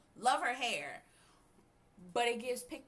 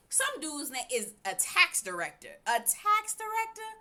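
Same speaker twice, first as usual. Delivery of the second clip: incredulous; 6 semitones higher, 5 dB louder than usual. Real, not acted. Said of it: "What in the hell is a tax director?".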